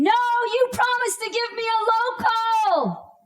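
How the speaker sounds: panicked shriek that cracks into crying; desperate scream. Retold or imitated. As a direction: scream it as the mask comes down. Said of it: "I protest and scream".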